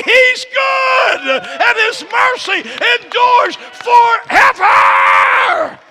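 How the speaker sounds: building to a high loud shout; preaching climax. Real, not acted. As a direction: preach it, stretching the last words. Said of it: "building preaching climax".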